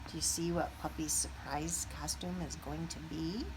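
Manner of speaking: ordinary delivery, nothing marked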